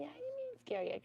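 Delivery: high, soft cooing. Real, not acted. To her frightened bunny, high soft cooing.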